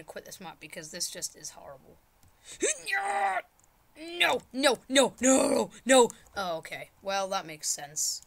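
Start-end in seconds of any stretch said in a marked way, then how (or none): 2.9-6.1 high, strained string of shouts; panicked protest. Real, not acted. Player watches the last try fail, panicked. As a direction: cry out no after no. Dying in game.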